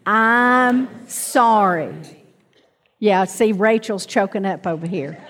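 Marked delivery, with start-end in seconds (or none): 0.1-1.9 drawn-out, deliberate I'm sorry; slow spoken apology. Shown as a demonstration. Preacher leading the congregation in a slow apology. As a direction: lead the room, saying it very slowly. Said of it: "Everybody say that".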